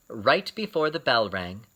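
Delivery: plain reading voice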